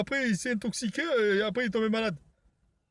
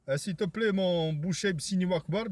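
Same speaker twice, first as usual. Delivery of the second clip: ordinary delivery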